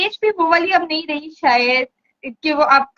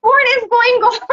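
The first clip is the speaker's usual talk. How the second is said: raised pitch, baffled reader's protest; exasperated, higher-pitched outburst; mock exasperation; 6 semitones higher, 4 dB louder, much slower than usual. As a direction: throw up the question in amused disbelief. Exasperated reaction to romance plots.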